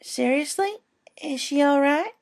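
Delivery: put-on a small girl asking her big sister; little filly voice. A narrator's reading. Apple Bloom asks worriedly.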